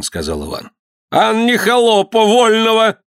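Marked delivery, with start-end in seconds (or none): 1.1-3.0 high, drawn-out accusing cry; angry retort. A narrator's reading. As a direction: hurl it back high and slow. Governor retorts in heated quarrel. (сердитое протяжное возражение высоким голосом)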